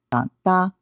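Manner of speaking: first DA low and falling, second DA high and level; low, then high flat. Shown as a demonstration. Teacher shows third plus first tone, DA low then DA high and flat. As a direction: step from low DA up to a long high DA. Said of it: "The third tone is going down a bit".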